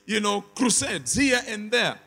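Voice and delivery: ordinary talk, nothing marked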